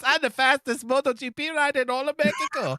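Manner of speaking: very high, squeaky Mexican cartoon voice; hammed-up cartoon racer, whooping in a Mexican accent; Mexican cartoon character. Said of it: "everyone's favorite Mexican cartoon character".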